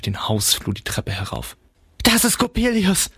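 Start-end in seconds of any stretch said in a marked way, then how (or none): 2.0-3.1 much higher, alarmed cry; frightened mother. A narrator's reading. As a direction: gasp it out high, blanching with dread. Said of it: "sagte meine Mutter erblassend".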